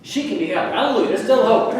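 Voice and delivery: high, loud hallelujah lift; jubilant good news. Real, not acted. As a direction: announce the hope with rising, loud excitement. Answering own question with good news.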